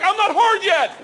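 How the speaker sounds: high, yelled mock plea; panicked protest. Comic stalls instructor before jump, high panicked yell. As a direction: stall desperately at high pitch.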